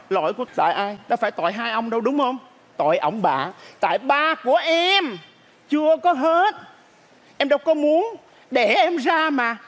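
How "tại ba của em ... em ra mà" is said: high, drawn-out comic complaint; hammed-up indignant grievance; aggrieved lament. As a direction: wail the blame, stretched and high. Child blames parents, lamenting.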